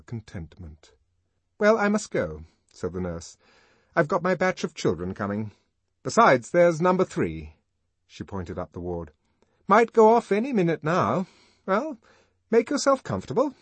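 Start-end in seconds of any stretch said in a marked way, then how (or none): 1.6-2.4 high, bright nurse voice; a busy nurse excusing herself; gentle calm nurse. Acted. Nurse leaving the ward. 3.9-13.5 high, louder, brisk nurse voice; a busy nurse in a hurry; gentle calm nurse. Acted. Nurse leaving the ward.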